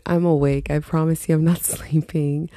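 ordinary talk

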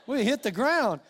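ordinary delivery

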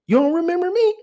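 high squeaky mock relative; high incredulous relative voice; imitating relative. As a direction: pitch it way up like an eager aunt. Relatives quizzing him at funeral.

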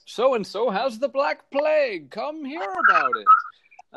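high, ringing herald's call; town crier. Pretend. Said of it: "town crier back then".

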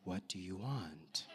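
low, soft question; hushed, low-pitched imitation of a calm question; gentle asking. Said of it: "It was probably like".

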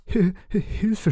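high, stammering cry for help; terrified stutter. Acted. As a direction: stutter out the word in panic. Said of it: "stotterte Nobby".

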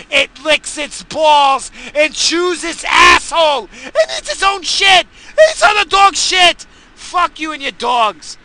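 loud, high, slow furious yelling; real rage, voice cracking; screaming rant. Real, not acted. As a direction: scream your disgust at the dogs. Ranting rage at dogs.

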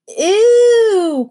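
high, loud squeal of disgust. Acted. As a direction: shriek at the smell. Opening musty shed, disgust.

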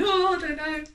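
flustered. Retold or imitated. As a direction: panicky high "I don't know". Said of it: "I'm like".

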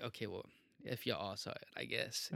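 low, soft, retold backing down; grudging concession. Retelling how he conceded to his friends, quiet and grudging. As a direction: drop your voice and give in reluctantly.

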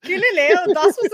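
playful fake German accent, laughing; teasing the cartoon with a jokey German accent; mock German accent. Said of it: "have a German accent".

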